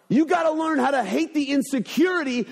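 ordinary delivery

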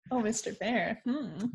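soft, breathy swoon trailing into a hum; swooning sigh. Retold or imitated. Joe swooning over Mister Bear, soft and dreamy. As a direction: melt a little on his name. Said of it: "Joe's like".

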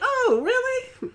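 very high, drawn-out mock surprise; exaggerated imitation of a surprised reply. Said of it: "You like".

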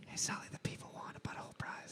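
unvoiced stage whisper to the boss. Real, not acted. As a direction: whisper it off-mic, quick. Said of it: "let me whisper in your ear".

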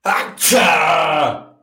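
loud real sneeze; sneezing. Real, not acted. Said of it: "Perdónenme ustedes, ya regresé".

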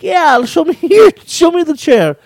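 high, eager imitation of a friend; excited retold reply. Storyteller acts out friend asking to see the chair, excited.

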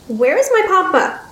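put-on high childlike voice; high, girlish demand; upset young girl. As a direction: ask like a little girl missing her father.

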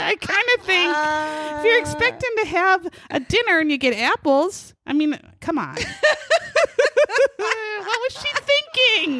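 can't stop laughing, words squeezed out; laughing through words. Real, not acted. Breaks up laughing mid joke.

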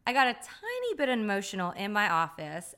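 ordinary talk

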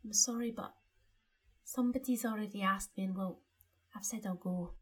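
gently voiced apologetic schoolgirl; Cho Chang impression. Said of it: "do Cho Chang".

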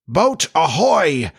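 slow, high ringing hail; pirate captain calling across the water; Hook. A narrator's reading. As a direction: stretch out a sailor's hail. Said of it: "again came the voice".

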